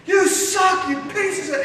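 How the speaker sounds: high, loud furious rant, slow and heavy; screaming manager. Retold or imitated. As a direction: scream it down at a losing team. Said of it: "he's screaming up and down the locker room".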